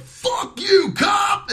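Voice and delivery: high-pitched mock heckler's scream; imitated screaming. Inmates heckling a former prison officer, imitated screaming. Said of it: "And, like, screaming".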